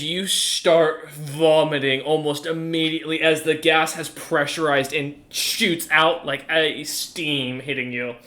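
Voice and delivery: ordinary talk, nothing marked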